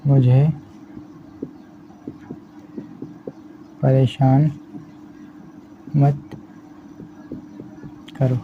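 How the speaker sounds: words drawn apart with long pauses; slow dictation. Shown as a demonstration. Teacher slowly dictating the sentence to the class. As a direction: spell it out slowly while writing. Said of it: "लिखना होता है".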